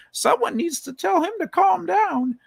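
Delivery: high, lightly voiced old lady; sweet old lady. Streamer quoting the old lady in the store, high and sweet. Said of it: "she says".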